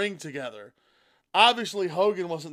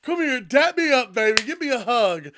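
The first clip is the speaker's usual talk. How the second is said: high, loud, hyped-up cheering; mock excited cheer; 7 semitones higher, 4 dB louder than usual. John Cena cheering Cody on, high and excited. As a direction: pump him up like a hype man. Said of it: "And John".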